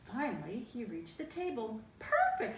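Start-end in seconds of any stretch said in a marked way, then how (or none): 2.0-2.6 very high, loud squeak; high mouse squeak. Acted. The mouse reaching the table, squealing high. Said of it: "said Mortimer".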